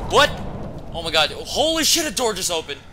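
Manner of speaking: high, loud shocked outburst; real jump-scare exclaiming; startled scare. Real, not acted. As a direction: yelp in fright, voice leaping up. Door opens by itself in game.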